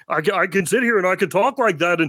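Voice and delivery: Ray Romano. Shown as a demonstration. Podcaster showing co-hosts his celebrity impression, Ray Romano voice. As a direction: talk like a complaining TV comedian. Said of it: "I can do Ray Romano".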